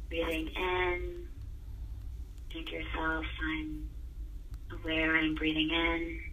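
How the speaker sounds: soft, slow meditation breath cue; soft spa whisper. Acted. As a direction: hush it gently.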